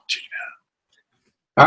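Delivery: slow drawn-out whisper. Pretend. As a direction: breathe the words out slowly. Catchphrase repeated as whisper.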